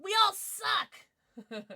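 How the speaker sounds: loud, high, drawn-out group roast; playful high-pitched yell of defeat; mock-despair shout. Real, not acted. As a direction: shout the shared defeat slowly. Everyone lost the guessing game.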